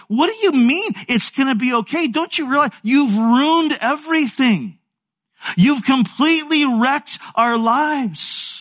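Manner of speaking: high-pitched, indignant acted outburst; angry accusation. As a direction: play a betrayed husband lashing out, slowing at the end. Acted quarrel, Joseph accuses Mary.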